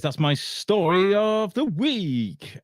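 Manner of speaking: high, very slow, stretched words; swooping, elongated segment tagline; drawn-out sign-off. Acted. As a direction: draw out the final words like a jingle.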